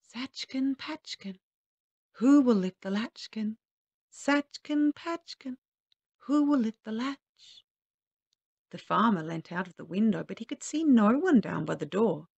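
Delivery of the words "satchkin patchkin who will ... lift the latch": high chanting magic man; high, bell-like sing-song rhyme; thin, clear little voice. A narrator's reading. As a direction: ring it out like a silver bell. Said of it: "thin and clear as the ring of a silver bell".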